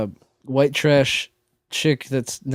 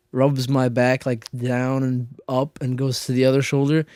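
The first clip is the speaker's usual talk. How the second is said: ordinary delivery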